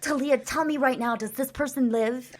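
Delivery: loud, high, pushy mock demand; bossy imitation of an impatient friend; demanding co-host. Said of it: "She'll say".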